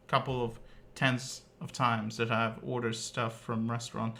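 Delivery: usual speaking voice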